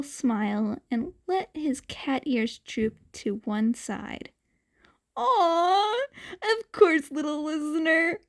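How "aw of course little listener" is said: doting coo. A narrator's reading. Hero melts at cute kid.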